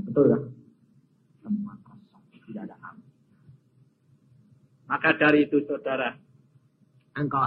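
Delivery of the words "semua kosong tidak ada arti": hushed, breathy whisper of "Semua kosong"; the preacher lets the congregation lean in, whispering. Acted.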